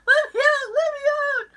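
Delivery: very high, loud mock wail; playful high-pitched cries for release; trapped prisoner. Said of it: "It's like I'm in prison".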